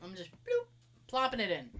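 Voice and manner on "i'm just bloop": sound effect. Retold or imitated. Repeats the dropping noise.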